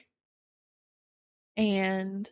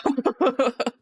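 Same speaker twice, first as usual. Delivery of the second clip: laugh. Real, not acted. Laughs at loud sound.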